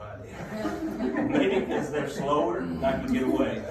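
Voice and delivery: laughing through the words. Real, not acted. Laughing at own joke.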